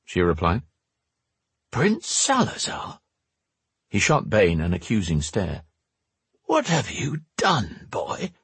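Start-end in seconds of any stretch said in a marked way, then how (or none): 1.7-3.0 put-on shocked old man. A narrator's reading. Max shocked. 6.5-8.3 put-on accusing old man. A narrator's reading. Max accusing Bane.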